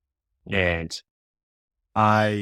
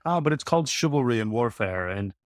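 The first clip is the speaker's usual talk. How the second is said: higher-pitched, badly faked English aristocrat accent; mock aristocratic accent; 5 semitones higher than usual. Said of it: "really bad English accent".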